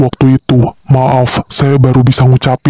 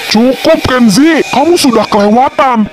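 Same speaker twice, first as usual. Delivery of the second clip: angry plea; 11 semitones higher than usual. Acted. Confronting Kenzi, defending Davina.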